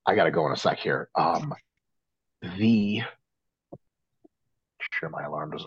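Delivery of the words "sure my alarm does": hushed aside under the breath; a quick whispered aside. Real, not acted. Aside while alarm goes off.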